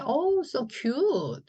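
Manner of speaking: high, drawn-out gush; cooing over cuteness. Acted. Teacher acting someone seeing something adorable, cooing. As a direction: coo it slowly with a high, stretched "cute". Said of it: "귀엽다고 할 때".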